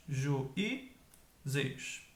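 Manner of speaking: "juízes" slowly as ju... í... zes; teacher spacing out "juízes", pausing between syllables; split into syllables. Shown as a demonstration. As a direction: break "juízes" into three separate syllables.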